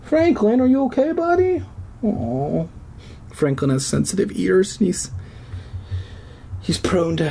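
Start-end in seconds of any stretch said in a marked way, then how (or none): 0.0-2.7 pet talk. Real, not acted. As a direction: coo at the hurt pet. Talking to pet, baby voice.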